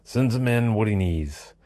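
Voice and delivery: high, loud, slow yell at the bartender; screamed order. A narrator's reading. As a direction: scream it across the bar, drawn out. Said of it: "screamed at the bartender".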